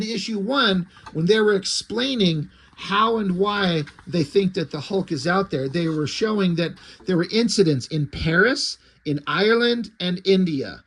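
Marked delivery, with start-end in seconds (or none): none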